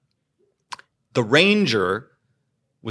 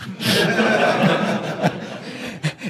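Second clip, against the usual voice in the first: rising, amused laugh; a light rising chuckle; high laugh; 10 semitones higher than usual. Real, not acted. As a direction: laugh at the cleric running off. Laughing at the cleric fleeing.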